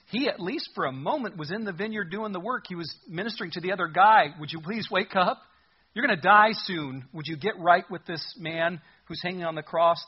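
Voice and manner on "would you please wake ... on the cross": urgent plea. Acted. Dying thief begging the other criminal to wake up, urgent plea. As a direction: plead with him, pitched up and forceful.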